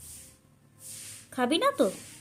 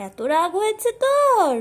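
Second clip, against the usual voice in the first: high, slow teasing; 8 semitones higher, 6 dB louder, slower than usual. Real, not acted. Teasing bird's anger.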